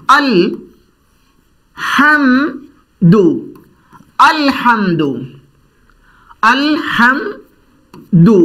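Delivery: अल हम दू broken into slow syllables with pauses; slow syllable drill. Shown as a demonstration. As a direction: spell it out with gaps. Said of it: "देखें आप".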